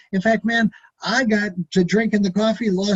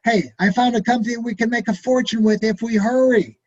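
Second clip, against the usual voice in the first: usual speaking voice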